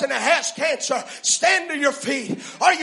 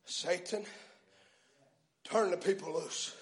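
hushed rebuke; 7 semitones lower, 15 dB quieter than usual. Real, not acted.

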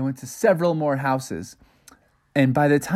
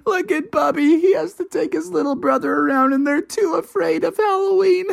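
higher, teasing voice; mocking kids; 13 semitones higher than usual. A narrator's reading. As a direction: sneer at the scaredy-cat. Other kids mock Bubby.

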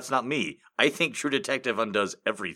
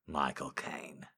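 slow whisper of the name; Michael Caine, whispered and drawn out; 5 semitones lower, 8 dB quieter, slower than usual. Shown as a demonstration. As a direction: breathe the name Michael Caine softly and slowly. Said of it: "which was which".

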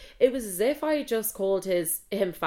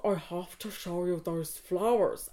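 imitating Rory; 4 dB quieter, slower than usual. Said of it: "I sound like an impression I do".

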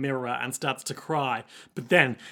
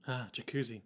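soft, low murmur; hushed, worried aside; deeply concerned; 5 semitones lower, 12 dB quieter than usual. Acted. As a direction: sigh it quietly with concern. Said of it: "looking deeply concerned".

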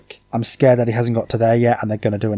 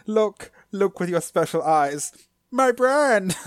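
dramatic advert voice; 7 semitones higher, slower than usual. Retold or imitated. Quoting the advert again.

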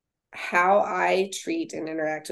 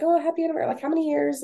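high-pitched congratulating voice, lightly acted; delighted well-wishers; 9 semitones higher, much faster than usual. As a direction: imitate friendly strangers cooing congratulations, pitched up. Voices older couples congratulating them.

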